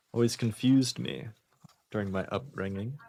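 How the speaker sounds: usual speaking voice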